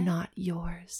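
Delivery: unvoiced eerie whisper. Shown as a demonstration. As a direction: whisper it like a ghost. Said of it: "whisper repeated".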